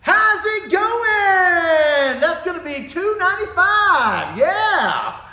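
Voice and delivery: high, loud, slow, sing-song cashier voice; cheery greeter. Retold or imitated. Imitating the cheery cashier at the window, high and loud. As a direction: play the overly happy window guy. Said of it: "he's like".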